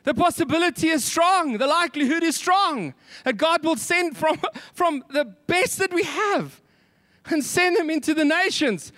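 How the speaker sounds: high, fervent full-voiced preaching. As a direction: lift it up and preach it out.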